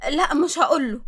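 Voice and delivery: usual speaking voice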